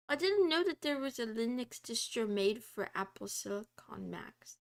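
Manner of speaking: usual speaking voice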